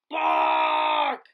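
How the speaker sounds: loud booming bark call; big bark shout. A narrator's reading. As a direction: shout it out like a watchdog.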